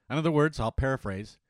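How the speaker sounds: ordinary talk, nothing marked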